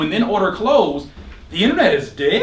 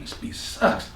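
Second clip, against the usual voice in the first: whispering; 9 dB quieter, slower than usual. Retold or imitated. Inner voice putting down beat.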